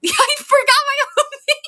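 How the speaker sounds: high, squealing laugh through the words. Real, not acted. Blanked on her own name.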